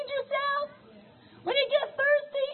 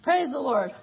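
usual speaking voice

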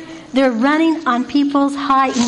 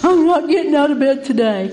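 grumbling mock refusal from bed; imitated sleepyhead. Speaker imitates Thomas under the covers, sleepy refusal. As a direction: imitate a man refusing to budge. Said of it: "He's got the covers over his head".

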